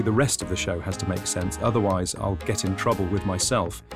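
ordinary talk, nothing marked

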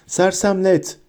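high, contained shout; cried-out spell. A narrator's reading. Harry aims at Bellatrix's back, crying out. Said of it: "diye haykırdı Harry".

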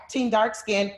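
normal talking voice

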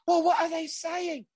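put-on a touch of London accent, demanding; light cockney put-on; Sharon's accent. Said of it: "Sharon goes".

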